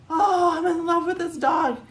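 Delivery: high, loud, teary gush of puppy love; a ten-year-old melting over a dog; gushing girl. Acted. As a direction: squeal it like she just fell in love.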